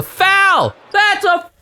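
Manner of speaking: very high, loud shriek of disbelief; outraged shriek. Real, not acted. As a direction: scream that it was a foul. Outraged scream at no call.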